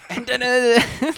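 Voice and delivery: movie bit quote. Retold or imitated. Riffing on the movie's "and then" part, mock quote. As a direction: parrot the movie line up high. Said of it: "they wouldn't have been able to do the "and then" part".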